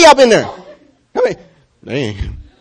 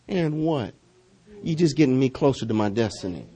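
defiant taunt; 7 semitones lower, 12 dB quieter than usual. Retold or imitated. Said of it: "He's like".

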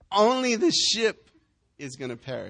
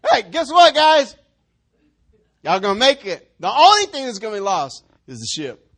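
mock cheerful announcement; 10 dB louder than usual. Preacher announces wryly to crowd.

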